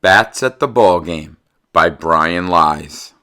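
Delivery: loud, slow storybook reading; picture-book read-aloud, slow and lively; reading to children. Acted. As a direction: read the rhymes out to the class with relish.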